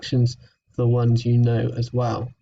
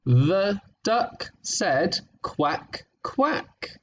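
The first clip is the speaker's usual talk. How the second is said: very slow, clear read of "The duck said quack, quack"; slow reading aloud; 5 semitones higher, much slower than usual. Shown as a demonstration. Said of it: "so this sentence here".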